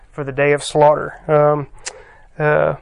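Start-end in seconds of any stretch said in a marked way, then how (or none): none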